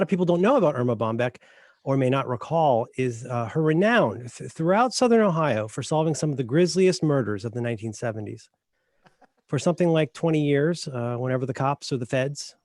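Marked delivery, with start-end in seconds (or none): none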